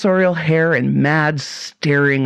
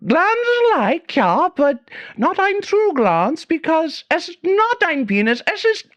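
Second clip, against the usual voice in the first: very high, slow, hammed-up German accent; fake German accent; 14 semitones higher, slower than usual. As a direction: play the mad German professor, thick fake accent. German professor continues.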